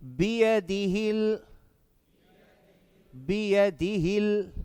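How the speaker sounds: slow chant, final L held; measured tajweed drill for students to echo; Quran recitation. Shown as a demonstration. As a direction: recite it evenly with sustained vowels.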